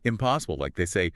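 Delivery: ordinary talk, nothing marked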